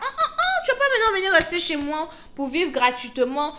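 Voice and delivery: high, laughing scolding; laughing mock refusal. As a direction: laugh them off while kicking them out. Acted quarrel, laughing.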